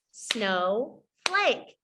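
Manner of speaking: "Snowflake" split as snow, pause, flake; two separate clapped beats on "Snowflake"; syllable by syllable. Shown as a demonstration. Teacher has pupils clap the sounds, syllable by syllable. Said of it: "Clap the sounds in snowflake".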